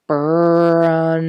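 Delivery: booming loud "Brum!" like a grouse drumming; loud drum call. A narrator's reading. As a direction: blast out "Brum!" so it echoes. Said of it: "sent a loud".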